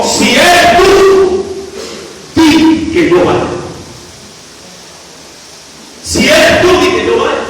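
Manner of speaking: high, slow, stretched out; pleading call. Acted. Peter calling to Jesus from the boat, high pleading. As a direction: call across the water to him.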